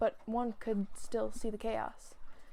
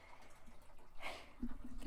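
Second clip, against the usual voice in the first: breathy sigh; 8 dB quieter than usual. Real, not acted. Tired after chasing cat.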